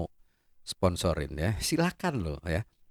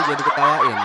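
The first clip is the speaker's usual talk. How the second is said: said through laughter; laughing; 6 semitones higher, 8 dB louder than usual. Real, not acted. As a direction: talk while still laughing. Laughing at himself. (bicara sambil tertawa)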